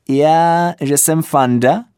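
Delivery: high, loud, offended denial; indignant protest. A narrator's reading. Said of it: "ohradil se".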